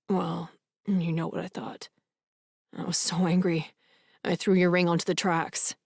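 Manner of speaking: a hint of a sheepish confession; Jake's rueful voice. A narrator's reading. Jake admitting the ring to Naomi, lightly voiced, soft and rueful.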